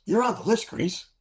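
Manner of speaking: sing-song announcement from the wife; high imitated woman. Liaison announces good news to pilot, high voice.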